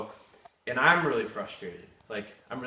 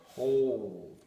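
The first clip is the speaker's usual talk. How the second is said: normal talking voice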